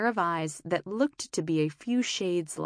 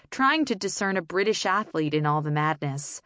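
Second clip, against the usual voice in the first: usual speaking voice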